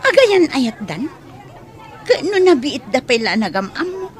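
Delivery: high, loud startled disbelief; shocked gasp. Acted. Maid shocked at hearing the boss will marry. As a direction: blurt out the shock.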